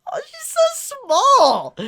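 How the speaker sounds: very high, slow, loud gushing; cuteness squeal. Real, not acted. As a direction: coo loudly and draw it out. Overcome by a tiny cute character.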